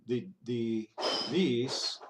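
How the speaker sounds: ordinary talk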